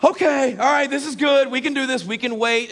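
high, loud, forced composure; comic barely-contained agitation; strained self-control. Retold or imitated. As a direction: talk yourself down while about to burst. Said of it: "my kids are seeing me shaking".

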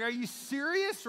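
can't believe what you're hearing; mock disbelief, stretched slow; incredulous. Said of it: "you're like".

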